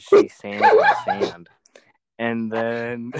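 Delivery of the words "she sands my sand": words break into high, loud laughter; slow, squealing laugh on the punchline; cracking up. Real, not acted. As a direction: lose it laughing while finishing the joke. Cracks up reading own joke.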